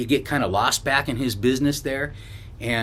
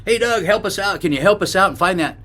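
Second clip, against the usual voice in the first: calling out; 7 semitones higher than usual. Retold or imitated. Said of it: "And I yelled".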